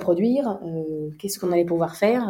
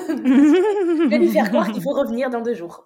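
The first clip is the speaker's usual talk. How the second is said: higher, drawn-out words through a laugh; amused confession; 4 semitones higher than usual. Real, not acted. Partner confessing her little fib, laughing. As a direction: laugh while owning up to the trick.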